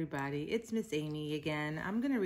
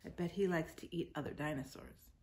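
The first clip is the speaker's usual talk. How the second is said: patient picture-book narration; reading to children. A narrator's reading. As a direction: read it aloud to little kids, drawing out the words.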